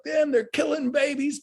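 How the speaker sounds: high, mock-outraged dad voice; a hammed-up impression of a fired-up father; imitating dad. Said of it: "but he's like".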